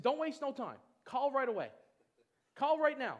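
urgent pushing. Retold or imitated. Friend urging him to call.

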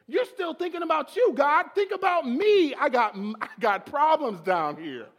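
high, loud indignant protest; indignant outburst. Retold or imitated. Troubled believer telling God off, indignant. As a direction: holler it in exasperation, dropping at the end.

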